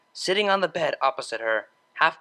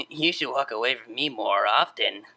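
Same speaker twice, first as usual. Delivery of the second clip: low sleepy chuckle; 5 semitones lower than usual. Acted. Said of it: "let out a low chuckle".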